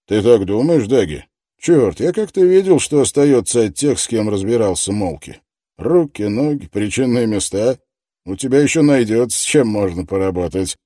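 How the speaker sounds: loud, pushy interrogator; tough detective voice. Acted. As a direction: press him hard, loudly. Detective threatens the suspect.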